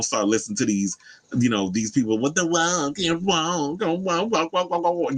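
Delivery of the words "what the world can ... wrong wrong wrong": high, drawn-out mock whining; mocking whiny complainers. Mimicking these people who throw woke around, high mocking whine. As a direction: imitate droning naysayers in a high, stretched voice.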